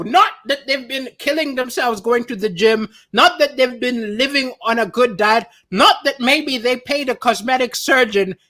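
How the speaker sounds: ordinary talk, nothing marked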